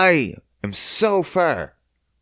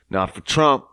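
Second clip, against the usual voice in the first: ordinary talk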